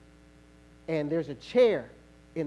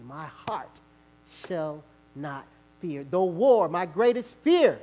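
scripture recitation; 3 semitones lower than usual. Acted. Recites Psalm 27.

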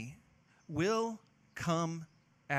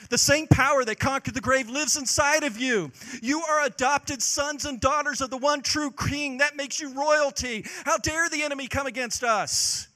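louder, rousing pulpit call to courage; full-force preaching; 5 semitones higher, 5 dB louder than usual. Acted. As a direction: build it like a rallying sermon. Preaching climax.

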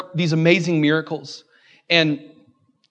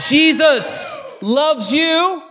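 preaching shout; 9 semitones higher, much slower than usual. Real, not acted. Pastor proclaiming God's love to congregation, slow shout. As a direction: cry it out to the room, stretched long.

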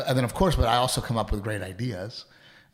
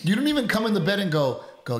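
usual speaking voice

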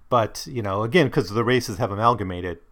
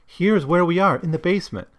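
high, louder fearful line; frightened stranger; 8 semitones higher, 4 dB louder than usual. Acted. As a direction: pitch up with alarm. Frightened stranger in read quote.

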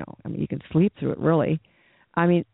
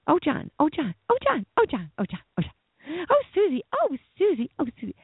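much higher, swooning oh John; mock lovesick repetition; 10 semitones higher than usual. Pastor mocking someone endlessly repeating a partner's name, swooning. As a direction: moon over the name like a lovesick fool. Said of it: "if you just kept saying".

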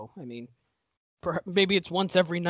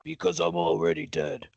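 deep, grim twist reveal; low ominous voice; 12 semitones lower than usual. Pretend. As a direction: drop it low like a ghost. Voicing Rocky.

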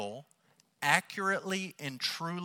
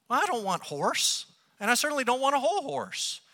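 high, louder mock protest; comic protest; 10 semitones higher, 4 dB louder than usual. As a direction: protest as if taken absurdly literally. Joking protest at literal reading.

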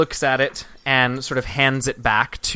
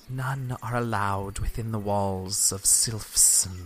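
soft, slow hiss of a guard, quieter than the narration; soft sibilant voice; 3 semitones lower, 6 dB quieter, much slower than usual. Acted. Gate guard warns the party off, slow and soft-sibilant. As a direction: let each s linger, slow and soft. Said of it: "in a very sort of soft and sibilant voice".

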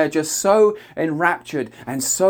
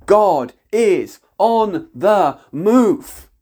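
loud, drawn out and higher, each word weighed; slow ringing declaration; 4 semitones higher, 4 dB louder, much slower than usual. Real, not acted. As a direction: proclaim it slowly like a gong. Climax of prophetic declaration.

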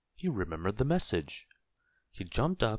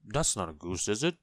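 hesitant, rising correction; mildly embarrassed second look; sheepish realization. A narrator's reading. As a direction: admit the mistake, voice climbing. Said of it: "he blushed".

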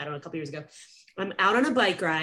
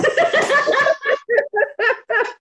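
loud high-pitched laughter; 8 dB louder than usual. Real, not acted. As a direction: burst out laughing. Laughing after her answer.

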